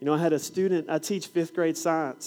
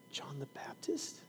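whispered question; 17 dB quieter, slower than usual. Retold or imitated. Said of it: "She goes".

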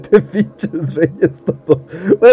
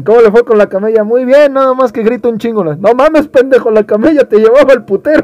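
excited barracks banter; 8 semitones higher, 5 dB louder than usual. Acted. Captain and soldier dialogue punchline.

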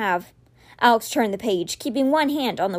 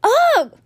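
high, loud exasperated grunt; frustrated groan; 13 semitones higher, 6 dB louder than usual. A narrator's reading. Boy groans as his spell fails, frustrated. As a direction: let out a frustrated ugh.

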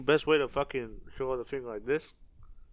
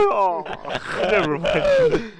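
laughing; 13 semitones higher, 8 dB louder, much slower than usual. Real, not acted. Laughs off his cracked voice.

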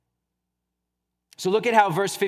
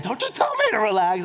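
a touch of mock outrage, pitched up; mock indignant retort; 4 semitones higher than usual. Stressed person answers someone telling them to relax, mock indignant. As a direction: snap back in playful offended tone.

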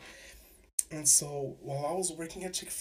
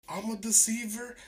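incredulous; 7 semitones higher, 4 dB louder than usual. Retold or imitated. Outraged echo of accusation.

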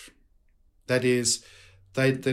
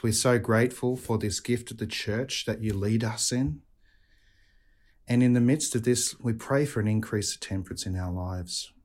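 calm, reverent prayer voice; closing prayer. Real, not acted. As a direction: pray it steadily and devoutly. Priest leads the closing prayer.

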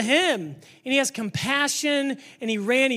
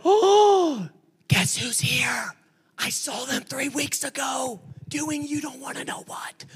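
gossipy churchgoer; 2 semitones higher, slower than usual. Retold or imitated. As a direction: imitate a shocked, nosy churchgoer. Churchgoers gossip about a newcomer.

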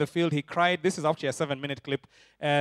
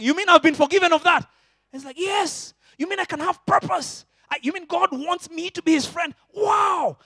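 much higher and louder, full of wonder; amazed new believer; 11 semitones higher, 7 dB louder than usual. Retold or imitated. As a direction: gasp with astonished joy.

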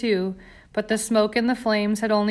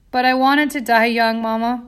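sobbed; 2 semitones higher, 5 dB louder than usual. A narrator's reading. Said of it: "Bella sobbed".

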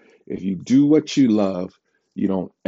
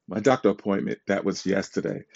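usual speaking voice

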